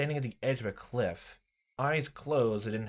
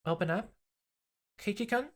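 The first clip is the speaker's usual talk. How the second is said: put-on creepy girl voice; 11 semitones higher than usual. Rena calling high.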